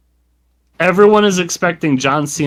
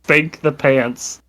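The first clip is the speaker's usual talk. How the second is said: ordinary delivery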